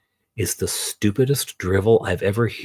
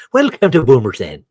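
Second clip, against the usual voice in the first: loud, higher, hammed-up Irish lilt; mock Irish accent; 5 semitones higher, 7 dB louder than usual. Villager greeting visitors to Boomers End, mock Irish accent. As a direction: belt out the welcome as a stage Irish villager. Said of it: "Now I'm in Ireland".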